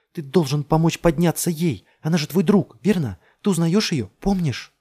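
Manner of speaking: high, loud, insistent pleading; desperate urging. A narrator's reading. Pleading with dazed man. (громко и отчаянно убеждает)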